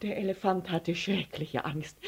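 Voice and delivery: ordinary delivery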